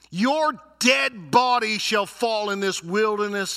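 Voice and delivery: loud, high preaching proclamation; thundering sermon reading; forceful scripture reading. Acted. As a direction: declare God's judgment to the congregation. Reads God's judgment at full force.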